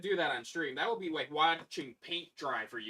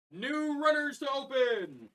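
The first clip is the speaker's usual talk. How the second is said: announcer voice; 6 semitones higher, slower than usual. Real, not acted. Announcing next runners.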